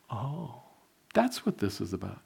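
quietly, as it dawns on you; soft realisation. Retold or imitated. Preacher realising why God made him sleep, softly.

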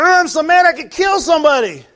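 high, loud imitation of rage; mock furious yell. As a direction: shout like you could kill someone. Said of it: "it was your".

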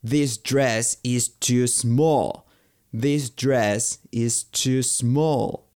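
drawn-out, careful model sentence; very slow teaching demo. Shown as a demonstration. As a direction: stretch every word for learners. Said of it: "teacher models English phrases".

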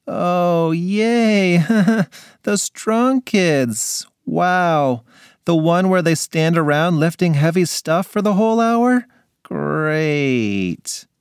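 flat fake cheer; sarcastic. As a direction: pretend to be thrilled. Forced smile, fake enthusiasm.

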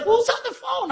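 very high, incredulous question; startled question. Retold or imitated. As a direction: re-enact a baffled, high-pitched demand to know. Retelling his startled question.

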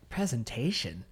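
stunned, hushed echo of the word; shocked disbelief. Retold or imitated. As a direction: gasp it back in quiet disbelief. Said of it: "I was like".

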